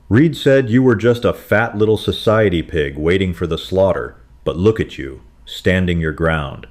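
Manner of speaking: ordinary delivery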